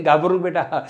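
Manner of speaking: ordinary talk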